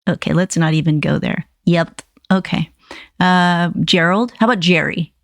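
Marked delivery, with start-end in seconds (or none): none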